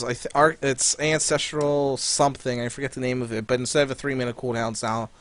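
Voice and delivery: usual speaking voice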